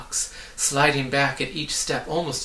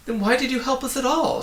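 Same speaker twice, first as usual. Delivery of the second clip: much higher, indignant shout; angry shout; 12 semitones higher, 5 dB louder than usual. A narrator's reading. Milo yelling at the voice, angry. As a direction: demand an answer in anger. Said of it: "shouted Milo angrily".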